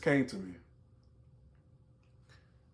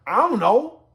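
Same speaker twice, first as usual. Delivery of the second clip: higher, louder, tossed-off denial; casual smart-mouth; 7 semitones higher, 8 dB louder than usual. Acted. Cain brushing off God's question, casually smart-mouthed. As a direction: run the words together, pitch lifting cheekily. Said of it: "he didn't say it like that".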